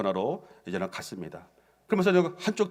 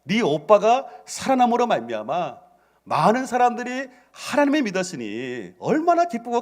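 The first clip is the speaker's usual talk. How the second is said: joyful Jesus voice; 8 semitones higher, 4 dB louder than usual. Retold or imitated. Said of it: "나는 도리어 기뻐한다는 거예요".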